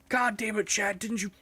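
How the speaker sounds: high, whiny fake rage at a teammate; mock blaming a teammate for not warning him; mock tantrum. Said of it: "We're like".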